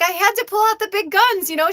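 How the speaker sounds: high, mock defensive mom; mother explaining herself to her daughter; mom defending herself. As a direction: justify it with a high voice.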